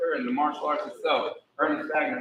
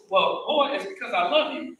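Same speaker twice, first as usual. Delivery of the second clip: imitating Pop; 8 semitones higher than usual. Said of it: "Pop would call me boy".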